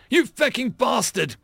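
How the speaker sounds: high-pitched, anguished curse; angry outburst. Acted. Marine slams his fist into dead friend, angry outburst.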